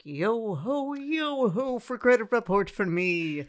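high, sing-song pirate chant; hammed-up pirate yo ho. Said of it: "I'm not gonna be like".

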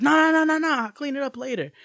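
high whiny protest, no no no; whiny kid. Retold or imitated. Teen girl pleading with her mother over the bag, high and whiny.

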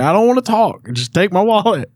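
very high, strained mock plea; frantic impatience, retold. Patient at the hospital counter, comically frantic. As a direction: imitate cutting someone off in desperation.

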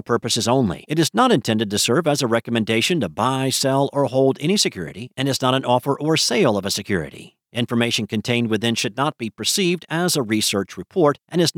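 quick, even compliance read; fast legal disclaimer. Real, not acted. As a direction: rattle off the fine print quickly. Legal disclaimer read at podcast end.

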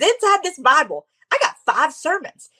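high-pitched preacher accent, imitated; Jensen Franklin's accent. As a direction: imitate a beloved pastor's accent from the pulpit. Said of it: "I'm trying to do his, his accent".

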